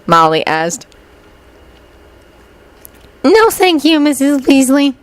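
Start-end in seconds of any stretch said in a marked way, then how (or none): 3.2-4.9 put-on high, courteous little-girl refusal; high-pitched, well-mannered; polite. A narrator's reading. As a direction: decline politely. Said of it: "Lily said politely".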